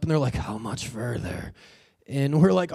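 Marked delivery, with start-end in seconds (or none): 0.3-1.5 slow, low, soft winded question; mock exhaustion, barely getting it out; huffing. Climbers huffing up ask those descending, out of breath. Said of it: "they're, like, huffing up".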